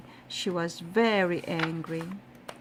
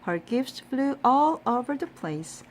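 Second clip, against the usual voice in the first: ordinary delivery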